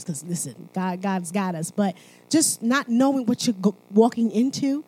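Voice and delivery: ordinary talk, nothing marked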